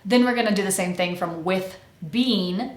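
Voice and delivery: usual speaking voice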